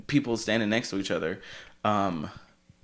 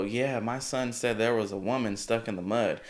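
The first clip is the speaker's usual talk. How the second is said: put-on country accent; 3 semitones higher, 5 dB louder than usual. Man who towed the speaker remarks aside, country accent. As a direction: imitate a country man, amused. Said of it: "he said it in the most countryest tone".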